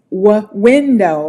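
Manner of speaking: window said slowly, tight rounded lips on the W; slow W demo. Shown as a demonstration. Teacher modeling window for a learner, slow and rounded. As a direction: stretch the W of window. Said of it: "lips to be tight and round".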